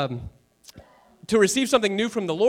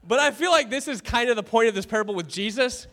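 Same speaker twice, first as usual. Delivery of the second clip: ordinary delivery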